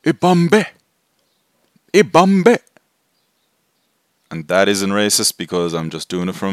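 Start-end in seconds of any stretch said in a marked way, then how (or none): 0.0-2.6 loud, high chant, imitating a film warrior; movie character's war cry. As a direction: belt it out as a mock battle cry. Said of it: "the guy in Avengers Endgame who goes".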